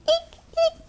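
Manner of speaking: tiny, soft, very high squeaks; mouse squeak. A narrator's reading. Mice scampering in the stable, soft high squeaks. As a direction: squeak softly like a little mouse. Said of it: "squeaked the scampering mice".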